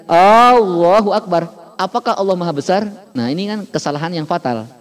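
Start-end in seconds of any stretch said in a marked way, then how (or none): 0.0-1.5 Allahu Akbar with the first A held long, slow; long hamzah. Shown as a demonstration. Teacher showing how lengthening the hamzah changes the takbir. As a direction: stretch the A of Allahu. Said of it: "kalau kita panjangkan hamzahnya".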